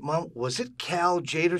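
acted, drawn-out loud question; insistent son. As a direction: spell it out slowly for mom. Son spelling it out to mother.

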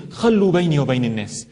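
low, fast Arabic saying; quick Arabic quote. Acted. Preacher quoting the Prophet to the crowd, low and fast. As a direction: rattle off the Prophet's words.

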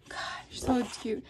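laughing whisper. Real, not acted. Gushing over Santa sticker.

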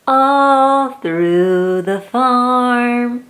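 drawn out slowly; slow refrain. Acted. Reading to a child, slow sing-song refrain. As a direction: stretch each word out.

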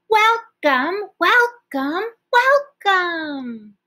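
welcomes called out high and loud; as loud as we can. Shown as a demonstration. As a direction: shout it out to the class. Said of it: "as loud as we can".

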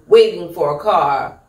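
plain reading voice

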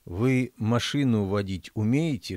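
plain reading voice

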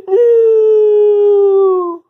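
loud, high, drawn-out mock wail; despairing wail. Bully facing angry parents, despairing wail.

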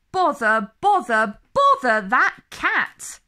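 exasperated scolding. Acted. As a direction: grumble it out like tired, cross parents.

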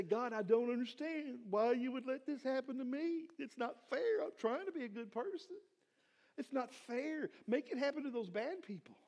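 mock a pity-party prayer; whiny complaint. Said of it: "How many times do we throw pity parties".

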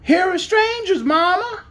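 put-on very high child calling out; shrill childish voice. A narrator's reading. Unseen child telling Mama of strangers, shrill. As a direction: pipe up shrilly like a small child. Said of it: "shrill and childish voice".

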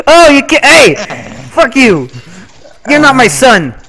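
high, loud hammed-up fury at a son; mock outrage. Father yells at his in-game son, hammed-up loud fury. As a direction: yell it like a betrayed father.

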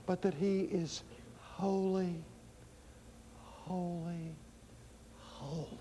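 hushed reverence. Real, not acted. Preacher repeating that he is holy, hushed reverence. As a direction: let each holy sink towards silence, long pauses.

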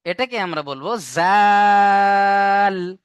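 জাল stretched long and loud; letter named in a recitation drawl; long drawn jaal. Shown as a demonstration. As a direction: hold জাল out slowly. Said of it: "letter name demo".

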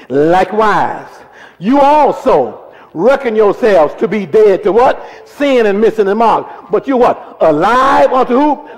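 loud, high call-and-response scripture reading; full-force preaching. Real, not acted. Preacher leading the congregation through a verse, full-force preaching. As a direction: drive the verse out loudly, drawing out key words.

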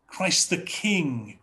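usual speaking voice

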